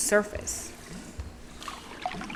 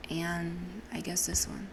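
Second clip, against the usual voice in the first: whisper; 12 dB quieter, slower than usual. Real, not acted. Quiet aside while choosing.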